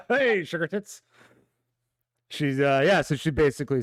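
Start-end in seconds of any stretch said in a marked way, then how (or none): 0.1-1.0 laughing. Acted. Trying out the NPC greeting.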